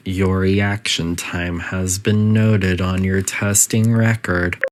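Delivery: calm, even automated announcement voice; a lightly voiced facility intercom; facility announcer. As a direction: read it like a polite recorded PA system.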